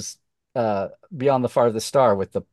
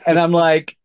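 usual speaking voice

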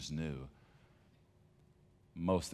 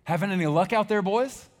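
loud, much higher shout across the water; called out from the shore; 15 dB louder than usual. Acted. Jesus calling from the shore to the boat, loud and high. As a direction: call out to fishermen far off. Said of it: "hear a voice from the shore that says".

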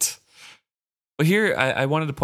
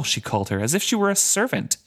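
usual speaking voice

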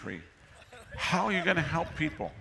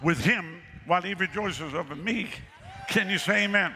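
higher and ringing, building preacher; preaching at full force; 5 semitones higher than usual. Real, not acted. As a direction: pour out the love to the crowd. Preacher rises to climax.

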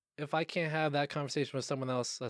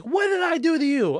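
screaming lady; 9 dB louder than usual. Retold or imitated. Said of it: "She's like".

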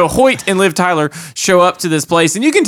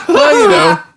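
laughing; slower than usual. Real, not acted. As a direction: laugh out the words. Laughing high.

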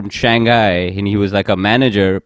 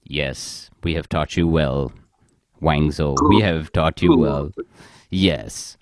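low, solemn chorus of approving managers; deep mock managers; 9 semitones lower, 7 dB quieter, slower than usual. Managers praising the ranting colleague, low solemn mock approval. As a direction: intone it like proud mentors. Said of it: "other middle managers are like".

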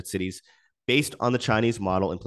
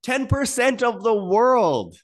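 mock official announcement, much higher and louder, the last line slow; mocking Chinese government; 5 dB louder than usual. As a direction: parody a pompous state broadcast in a high, loud put-on voice. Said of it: "the Chinese government says".

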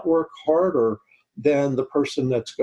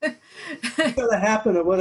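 laughing; 8 semitones higher than usual. Real, not acted. Joking reply, laughing along.

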